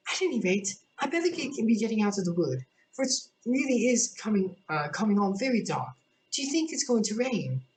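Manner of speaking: put-on a young girl putting on a brave face; Alice brushing off tears; Alice, cheerful. Alice voiced through the story.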